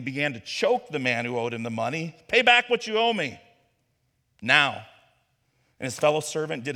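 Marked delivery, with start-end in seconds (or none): 2.3-4.9 angry demand. Acted. Servant demands his debt.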